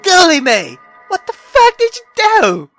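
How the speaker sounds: normal talking voice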